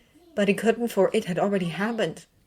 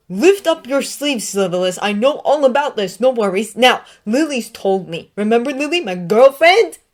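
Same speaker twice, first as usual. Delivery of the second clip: mocking taunt; 6 semitones higher, 10 dB louder than usual. A narrator's reading. Sirius jeering at Snape about his sleeves, loud mock. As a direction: lean on lift, jeering.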